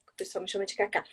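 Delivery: ordinary talk, nothing marked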